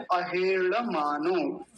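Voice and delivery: अहेड़मानो slow, ड़ said like a retroflex l; tongue curled up for the ळ in अहेड़मानो; careful Sanskrit. Shown as a demonstration. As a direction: retroflex ळ demo. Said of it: "ये ल जैसा उच्चारण होता है".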